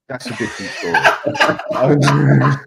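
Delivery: breaking into laughter while talking; laughing. Real, not acted. Man cutting short his risqué holiday story, laughing. Breaks up over own anecdote.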